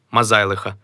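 normal talking voice

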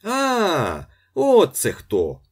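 high, loud, drawn-out greeting cry; friendly shout. A narrator's reading. Ostap recognizing the priest, friendly shout. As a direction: hail them loudly and slowly. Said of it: "доброзичливо закричав Остап".

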